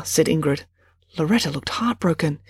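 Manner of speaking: usual speaking voice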